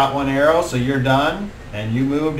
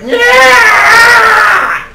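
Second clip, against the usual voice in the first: long, loud high-pitched screech; creature's pained shriek; 12 dB louder than usual. Acted. Game master voicing a creature struck by an arrow, pained shriek. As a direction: shriek like a wounded monster. GM voices wounded creature's cry.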